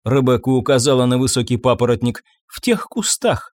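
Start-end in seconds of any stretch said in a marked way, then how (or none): 2.5-3.5 put-on high child voice. Child's quoted line.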